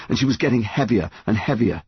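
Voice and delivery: usual speaking voice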